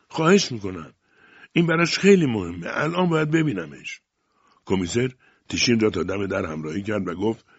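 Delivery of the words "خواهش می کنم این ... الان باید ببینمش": rising into a high, louder plea; urgent pleading. A narrator's reading. Tishin begging the commissioner to see the child now, pleading urgently. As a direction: beg insistently, voice climbing high.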